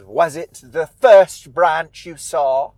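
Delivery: put-on old witch questioning with drawn-out, weighty words; [name]. Witch quizzing Lara about the branch, slow imperious witch voice. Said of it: "she comes back and she says".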